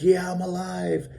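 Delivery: high, drawn-out cry of relief; retold elation. Man telling how he survived the river, elated high cry.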